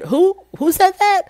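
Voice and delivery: husband caught off guard, in a high imitating voice; mock surprised husband. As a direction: imitate his startled disbelief. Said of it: "the husband was like".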